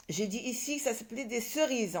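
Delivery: usual speaking voice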